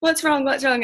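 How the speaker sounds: high, worried colleague asking what's wrong; concerned colleague. Retold or imitated. Colleague at the shop asks what's wrong, high and concerned.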